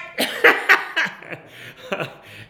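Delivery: loud, high breathy laugh; can't hold back the laughter; big real laugh. Real, not acted. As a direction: burst out laughing. Laughing at his own story.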